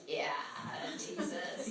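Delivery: breathy chuckle on Jesus; amused knowing reply; soft voiceless laugh through the name; laughing aside. Retold or imitated.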